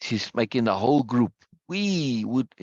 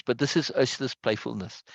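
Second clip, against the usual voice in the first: ordinary talk, nothing marked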